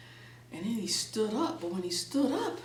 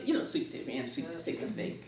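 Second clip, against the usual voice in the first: plain reading voice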